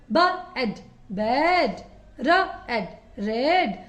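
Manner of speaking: slow phonics demo of बेड; slow blending. Shown as a demonstration. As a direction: sound out एड, then बेड, very slowly. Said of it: "इस तरह से जो sound आता है".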